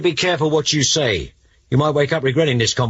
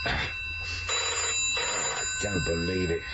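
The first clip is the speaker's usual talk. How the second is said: groggy just woken; 7 semitones lower, 11 dB quieter than usual. Acted. Woken by phone at night.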